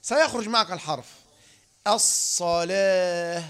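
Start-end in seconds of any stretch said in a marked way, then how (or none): 1.9-3.4 "الصلاة" very slow, long hissed ص; tongue-raised articulation. Shown as a demonstration. Teacher shows the letter coming out, drawn-out "الصلاة". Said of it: "ارفع بس لسانك لسقف الحنك الأعلى".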